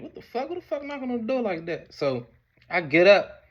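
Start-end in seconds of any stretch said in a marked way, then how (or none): 0.0-1.9 high startled what-the-fuck. Retold or imitated. Teen on the couch startled by knocking. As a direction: jump at a knock in the night.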